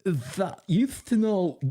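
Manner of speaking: high slow mock voice of Becca to the producer; mocking impression. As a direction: drag it out like a smug gossip. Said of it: "She's like".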